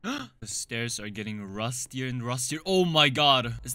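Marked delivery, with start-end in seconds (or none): 2.7-3.5 sudden loud "oh my god"; startled gasp. Real, not acted. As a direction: jolt of real shock. Sees blood in horror game.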